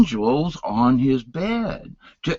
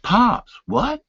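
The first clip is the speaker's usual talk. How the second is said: much higher, shocked question; startled; 11 semitones higher than usual. Retold or imitated. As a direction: caught off guard by his father. Son startled by request.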